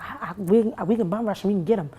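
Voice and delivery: rally everyone to rush him, loudly; a friend urges the group to rush the gunman, loud and urgent; urgent loud call. Retold or imitated.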